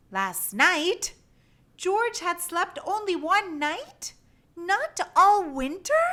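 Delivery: rising cry of disbelief; shocked little monkey; dismayed surprise. Acted. George dismayed at sleeping one night.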